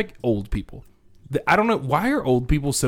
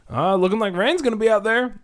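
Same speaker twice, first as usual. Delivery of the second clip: mock small talk; 8 semitones higher than usual. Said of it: "would be like".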